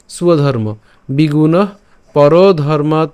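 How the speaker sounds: স্বধর্ম as "suwa-dharma", then বিগুণ, each set apart; word-by-word teacher demo of স্বধর্ম, বিগুণ, পরধর্মাৎ; careful pronunciation. Shown as a demonstration. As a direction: model পরধর্মাৎ slowly, ending on a closed t. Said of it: "mainly উচ্চারণটা কেমন হবে".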